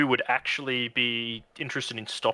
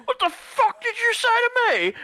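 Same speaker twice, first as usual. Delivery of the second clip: much higher furious yell; angry brawler; 4 dB louder than usual. Acted. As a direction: pick a fight. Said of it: "turn around and go".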